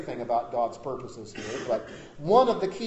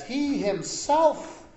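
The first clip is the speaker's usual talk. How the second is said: slow, higher, "He himself" drawn out; emphatic; 9 semitones higher, 3 dB louder, much slower than usual. Shown as a demonstration. Pastor stressing Peter's words "He himself" to the congregation, emphatic. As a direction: stretch "He himself" slowly on a raised pitch. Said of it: "it's emphatic".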